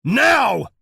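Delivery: very loud, high barked command; shout. A narrator's reading. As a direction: bark it at full force. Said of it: "the sheriff shouts".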